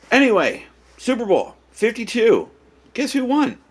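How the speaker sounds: loud, high burst of excitement; excited. Real, not acted. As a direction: switch to hype, loud and high. Excited topic switch.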